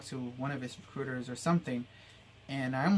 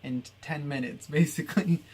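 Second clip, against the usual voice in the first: laughing; 6 dB louder than usual. Real, not acted. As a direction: laugh at yourself as you say it. Laughs at rushing ten years.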